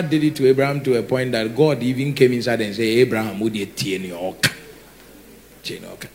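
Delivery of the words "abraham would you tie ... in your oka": low, soft aside, trailing away; hushed quoted question. Acted. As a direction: drop low and quiet as if quoting. Acts out God questioning Abraham.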